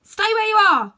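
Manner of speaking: loud, high alarmed cry. Acted. Boy crying out to the witch to stay back, loud and high. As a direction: stop her with a shout. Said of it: "cried Diggory".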